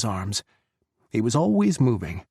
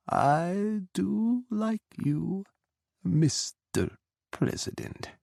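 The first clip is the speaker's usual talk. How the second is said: slow, much higher, sly smirk; 11 semitones higher, slower than usual. Acted. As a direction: drawl it out slowly like a cat toying with prey.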